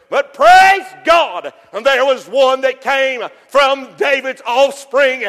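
slow, loud praise God; full-force preacher climax; preaching shout. Acted. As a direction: build to a triumphant cry. Sermon rises to shout.

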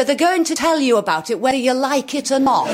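high, insistent northern-comic delivery; emphatic northern comic. Acted. Imitating northern comics for the audience, very emphatic. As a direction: tell them emphatically, like it or not. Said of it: "a lot of northern comics".

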